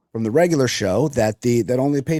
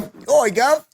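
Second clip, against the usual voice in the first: higher, hammed-up Cockney oi; mock British accent; 11 semitones higher, slower than usual. Host plays along in a mock British accent. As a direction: answer him back in mock Cockney. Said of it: "an accent like [name]".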